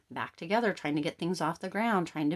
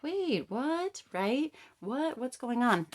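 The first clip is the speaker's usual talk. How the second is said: high, startled double take; baffled disbelief; 8 semitones higher, much slower than usual. Retold or imitated. Client hearing the partner's sudden romance, high baffled surprise. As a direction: sound thrown by what you just heard.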